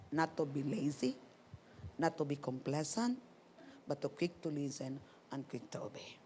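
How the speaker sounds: whispered resolve. Real, not acted. Pastor telling the group her promise to herself, whispered. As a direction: breathe the words out with no voice.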